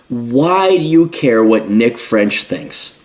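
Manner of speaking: slow, weighty repeat of the question; deadly serious. Real, not acted. Commentator pressing the viewer, slow and serious. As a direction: lean on "you" and slow right down. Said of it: "I'm being deadly serious".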